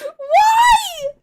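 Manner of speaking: mock-horrified shriek; shocked shout. Real, not acted. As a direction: yelp out in mock horror. Shocked reply to the joke.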